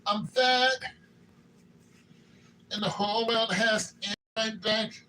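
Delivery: a playful nod to [name]; sing-song hint of the King of Pop; [name] impression. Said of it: "you can imagine [name] fat".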